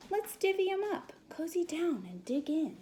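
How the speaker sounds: cheery badger. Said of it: "Badger says with a grin".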